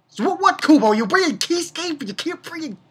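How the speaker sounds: high-pitched, incredulous outburst at the author; mock exasperated rant.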